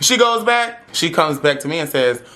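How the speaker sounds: ordinary talk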